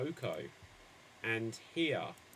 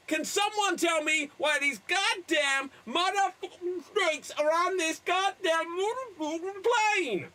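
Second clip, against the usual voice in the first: high, shouted mock Samuel L. Jackson rant; hammed-up furious movie shout; 10 semitones higher than usual. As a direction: yell the famous line in a hammed-up Jackson voice. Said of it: "you're Samuel L. Jackson".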